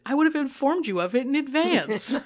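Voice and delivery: N=Wynn/character; sweetly toxic Wynn. Retold or imitated. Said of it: "she's sweetly toxic as always".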